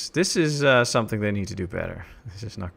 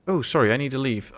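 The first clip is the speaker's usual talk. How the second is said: ordinary talk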